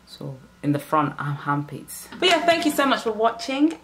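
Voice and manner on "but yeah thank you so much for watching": upbeat sign-off. Real, not acted. Bright sign-off to viewers.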